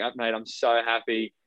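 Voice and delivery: ordinary delivery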